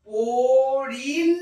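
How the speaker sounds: পড়িল dragged out slowly with a firm final ল; teacher sounding out পড়িল to find the ending; slow demo pronunciation. Shown as a demonstration. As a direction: say পড়িল syllable by syllable, lingering on the ending. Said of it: "এটিও আমি উচ্চারণ করব".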